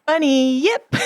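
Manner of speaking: "Bunny yip" with a high, rising yip; playful mispronunciation. Shown as a demonstration. Co-host playing with the creature's name, "Bunny yip" rising on yip. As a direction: sing "Bunny yip" upward, questioning. Trying out silly pronunciations.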